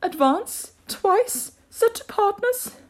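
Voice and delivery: high, loud, drawn-out dance calls; high turtle calling a dance; Mock Turtle. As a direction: call the steps slowly in a high voice. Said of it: "the Mock Turtle said".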